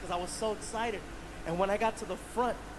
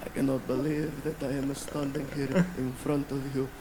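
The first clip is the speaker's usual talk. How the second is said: put-on lower, awed fan voice with an accent; emotional fan; 7 semitones lower than usual. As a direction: sound moved almost to tears. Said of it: "he's just like".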